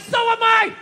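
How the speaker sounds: husband snapping back at wife, hammed-up; mock exasperated shout. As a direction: throw it back at her, fed up. Retorting to wife.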